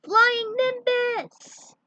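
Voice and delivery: high, slow, drawn-out call to the cloud; loud call. Acted. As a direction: summon the flying cloud from afar.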